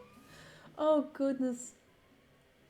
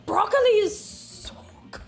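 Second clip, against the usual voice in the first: gushing delight; 9 semitones higher, 4 dB louder than usual. Real, not acted. Gushing about broccoli.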